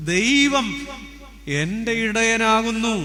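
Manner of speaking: high, loud, slow proclamation; solemn loud declaration; proclaiming. Acted. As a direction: declare it slowly to the church. Proclaiming the psalm line.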